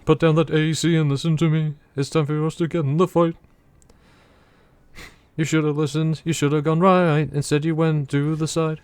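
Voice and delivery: rally the troops like a film general; commander voice. Acted. Playing a commander addressing his mech lance.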